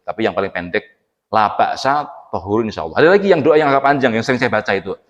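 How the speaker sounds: normal talking voice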